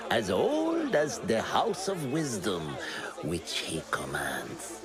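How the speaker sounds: usual speaking voice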